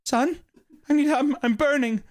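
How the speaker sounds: ghostly mother. Retold or imitated. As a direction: beg for help like a burning ghost. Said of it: "your mother's voice".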